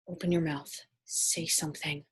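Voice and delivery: hushed, self-urging inner voice; soft words said to oneself. Retold or imitated. Urging herself silently at dinner.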